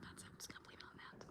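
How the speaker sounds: mock secret whisper; voiceless embarrassed whisper. Embarrassed aside to herself.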